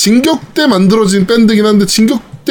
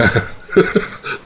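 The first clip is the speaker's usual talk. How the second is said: soft breathy laugh; 7 semitones lower, 7 dB quieter than usual. Real, not acted. Laughing at the teasing.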